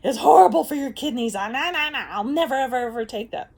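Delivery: high, loud mock protest; hammed-up horrified friend refusing; emphatic friend. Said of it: "she's just like".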